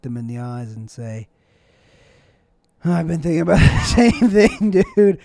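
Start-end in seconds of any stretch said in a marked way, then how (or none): 2.8-5.2 much louder, laughing burst of agreement; excited shout. Pretend. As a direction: blurt it out loud, through a laugh. Oracle answers the pilgrims excitedly.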